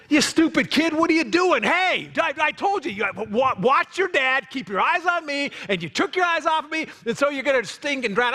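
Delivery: hammed-up angry dad yelling at his child; mock scolding parent. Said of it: "I'd start scolding them".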